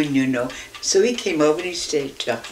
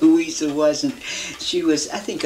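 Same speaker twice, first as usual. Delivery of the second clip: ordinary talk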